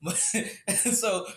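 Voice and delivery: usual speaking voice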